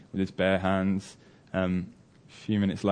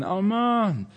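high, long falling call; shouting for his son; 11 semitones higher than usual. Retold or imitated. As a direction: imitate a father calling out for his child. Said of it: "always shouting for his son".

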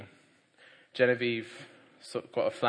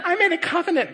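excited mum; 12 dB louder than usual. Retold or imitated.

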